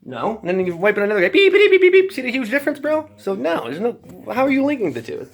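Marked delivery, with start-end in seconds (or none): 1.3-2.1 high, loud imitated beeping; Geiger counter beeps. Showing the kid the counter, loud mock beeping. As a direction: chirp out a counter going off. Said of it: "So get a Geiger counter".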